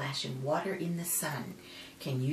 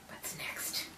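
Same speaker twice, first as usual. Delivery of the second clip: hushed whisper; 11 dB quieter than usual. Acted. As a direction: whisper it like a secret. Whispered page turn.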